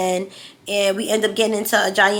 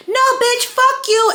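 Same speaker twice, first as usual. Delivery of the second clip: retold angry shout; 13 semitones higher than usual. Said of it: "She's still going".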